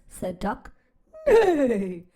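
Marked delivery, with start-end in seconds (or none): 1.2-2.0 a laughing whinny, louder; horse neigh. Acted. Reading to kids, the horse neighs back at Duck. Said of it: "said Horse".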